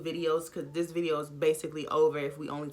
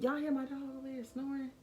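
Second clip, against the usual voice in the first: normal talking voice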